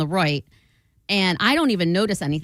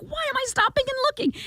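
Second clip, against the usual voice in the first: very high, fast flustered squeak; scolding herself in a squeaky panic; high embarrassed babble; panicked self-question; faster than usual. Retold or imitated. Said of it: "And then I'm like".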